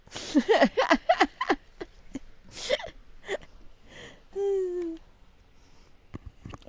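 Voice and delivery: long, loud helpless laugh; loud laughter. Real, not acted. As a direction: laugh until it almost cries. Big laugh at game.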